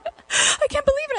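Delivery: very high, tearful mock disbelief; hammed-up teen despair; mock wail. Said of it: "And I'm like".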